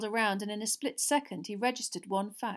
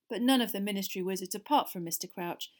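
ordinary delivery, nothing marked

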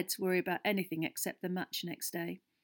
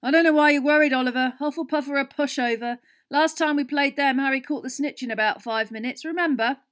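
loud, high, dismissive reassurance; impatient; 7 semitones higher, 11 dB louder than usual. A narrator's reading. Fred telling the captain not to worry, impatient. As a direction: brush off the worry noisily. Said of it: "said Fred impatiently".

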